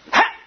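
short explosive burst of breath; sudden "Ha!". Retold or imitated. Preacher imitating a minister releasing healing, sudden "Ha!". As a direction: punch out one abrupt "Ha!".

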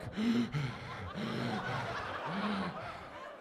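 long, soft overfed gasp; food coma gasp. Retold or imitated. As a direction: gasp like you're too full to move. Eyes rolling from overeating.

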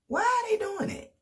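high incredulous squeal. Retold or imitated. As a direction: squeak it out in disbelief. Exasperated inner question.

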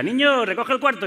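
voz aguda de madre que ordena; madre regañando. Retold or imitated. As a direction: imita a una madre llamando al niño. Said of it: "cuando tu madre entraba y te decía". (madre mandona, en tono agudo)

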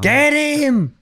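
mock charge, yell it out; hammed-up conquistador yell; battle cry.

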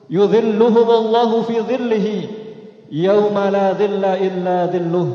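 ordinary talk

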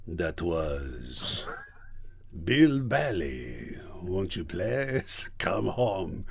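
put-on low, very slow French accent. Hairdresser's second take after being asked for energy, sluggish French accent. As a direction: drag it out in a thick French accent. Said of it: "what's with the French accent".

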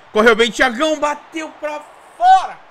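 excited cheer. Real, not acted. Repete a frase crescendo. (torcida empolgada)

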